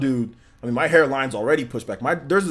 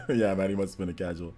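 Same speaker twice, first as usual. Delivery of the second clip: low chuckled aside with a laugh; amused laughing; 3 semitones lower, 4 dB quieter than usual. Real, not acted. Streamer joking the barber is a casual, chuckling. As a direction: let laughter spill between the words. Laughing at his own joke.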